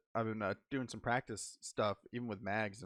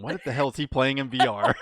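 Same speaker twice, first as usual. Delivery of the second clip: very loud incredulous question; mock bafflement; 3 semitones higher, 12 dB louder than usual. Voicing coworker Rob watching VR antics, loud mock bafflement. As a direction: imitate a baffled onlooker, loudly. Imagined coworker seeing odd movements.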